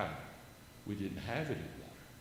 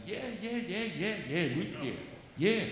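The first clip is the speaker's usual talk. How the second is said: eager shopkeeper; 6 semitones higher than usual. Retold or imitated. Shopkeeper answering about root beer.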